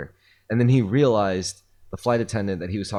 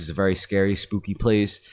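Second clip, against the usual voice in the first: usual speaking voice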